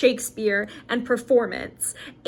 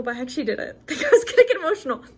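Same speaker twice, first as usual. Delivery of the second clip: quick, high, laughing through the words; laughing through tears; 5 semitones higher, much faster than usual. Real, not acted. Vlogger laughing at herself for tearing up, laughing. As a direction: laugh it off while still teary.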